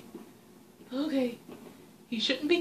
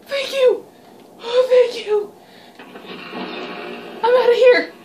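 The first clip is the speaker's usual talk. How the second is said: high, loud relieved shout; relieved exclamation; 6 semitones higher, 5 dB louder than usual. Real, not acted. Player escaping the monster, shouting with relief. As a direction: yell with relief as you flee.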